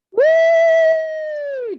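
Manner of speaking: long, high, loud woo; sustained high-pitched cheer; cheering whoop. Real, not acted. Host welcomes best friend onto the show, loud whoop. Cheering the next guest's arrival.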